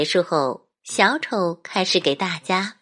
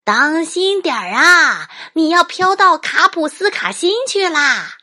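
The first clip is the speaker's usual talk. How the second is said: loud, high call upward; 11 semitones higher, 6 dB louder than usual. Acted. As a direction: shout a warning after her. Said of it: "小丑说".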